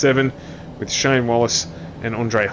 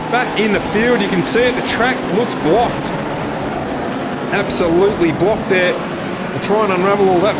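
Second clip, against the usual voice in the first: excited; 6 semitones higher than usual. Real, not acted. Big crash at race start.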